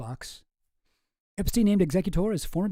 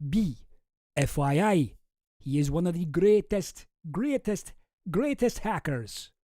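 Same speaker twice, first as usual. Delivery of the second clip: put-on hammy Russian accent, savouring the praise; Boris with Russian accent; slower than usual. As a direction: stay in the mock-Russian voice. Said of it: "with the right accent".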